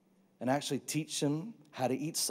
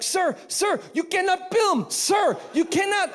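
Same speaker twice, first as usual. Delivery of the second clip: high, loud scolding nurse; stern nurse; 6 dB louder than usual. Retold or imitated. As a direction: shout at him to stop filming like a strict nurse. Nurse stops him filming.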